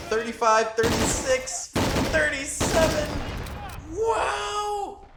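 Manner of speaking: amazed shouting. Real, not acted. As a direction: yell in disbelief at each kill. Cheering rising kill count.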